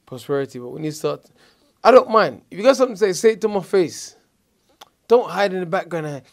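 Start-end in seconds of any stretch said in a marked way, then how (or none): 1.8-6.2 raised, higher voice, squaring up; confrontational challenge. Acted. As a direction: throw down the challenge, loud and direct. Acting out confronting trash talkers.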